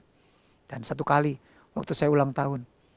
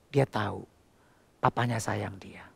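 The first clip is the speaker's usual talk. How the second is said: tender whisper; 8 semitones lower, 8 dB quieter than usual. Acted. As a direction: breathe it softly, like a secret. Tender climax of the story.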